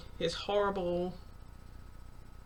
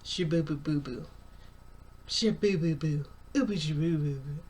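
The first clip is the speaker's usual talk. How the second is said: put-on cooing baby talk to an infant. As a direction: babble like talking to a baby. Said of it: "baby talking is the funniest thing".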